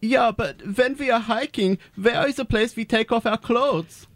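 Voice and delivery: put-on thick German tourist accent. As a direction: play a blunt German nudist. Nudist tourist, German-ish accent.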